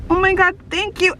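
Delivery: high-pitched gushing thanks; retold fangirl gushing; starstruck thanks. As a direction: thank your idol, overwhelmed. Retold fan thanks for compliment.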